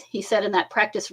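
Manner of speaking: plain reading voice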